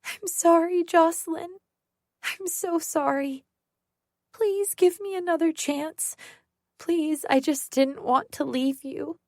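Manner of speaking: high, tearful begging; whimpering apology. Acted. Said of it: "Sarah whimpers on the floor".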